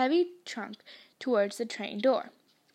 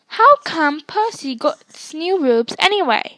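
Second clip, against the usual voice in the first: whiny complaint; 4 semitones higher, 10 dB louder than usual. A narrator's reading. Twin complaining.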